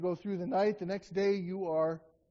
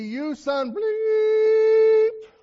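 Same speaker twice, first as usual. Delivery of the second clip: much higher, louder, long held bleep sound; mock bleeped curse; 13 semitones higher, 9 dB louder, much slower than usual. Preacher imitating a TV-censored curse, shouted bleep. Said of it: "if it were to be on T V".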